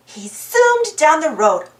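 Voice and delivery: usual speaking voice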